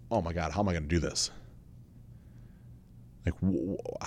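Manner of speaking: soft, hurried mock panic, ending in a stammer; retold shaky nerves. Podcaster recalls facing the camera, quietly imitating his nervous panic. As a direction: quietly act out nervous self-talk, faltering at the end. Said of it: "like, shaking".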